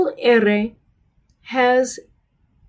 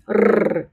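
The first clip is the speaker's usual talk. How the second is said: rolled rrr sound; trilled r. Shown as a demonstration. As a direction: roll the double r. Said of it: "has a trilled sound".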